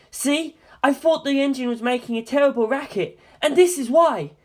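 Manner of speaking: high, agitated and urgent; rattled pilot insisting he was right; worked-up pilot showing the loose engine screws; beside himself. Acted. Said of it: "quite beside himself".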